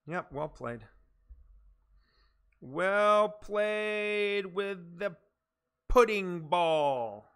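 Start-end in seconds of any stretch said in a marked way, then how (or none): 2.6-7.3 very slow, stretched-out hype announcer; drawn-out announcement. Pretend. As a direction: draw every word out grandly. Repeats praise as grand announcement.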